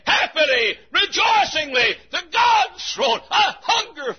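high, loud, ringing preacher's shout; full-force preaching. Acted. Preacher calling his people to God's throne, shouting high. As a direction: preach it at the top of your lungs.

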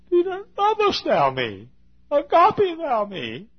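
Jesus aching for Peter's love; pleading Jesus. Retold or imitated. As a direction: stretch the question out, begging.

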